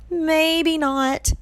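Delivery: high-pitched quick retraction; sheepish correction. A narrator's reading. Grover corrects himself after the roar, pitched high. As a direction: take it back from on high. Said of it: "Grover corrected".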